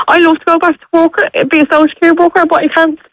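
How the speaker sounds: words cracking through real sobs; crying. Real, not acted. Mother telling host she can't go back to work, sobbing. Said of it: "I can hear the, the emotion".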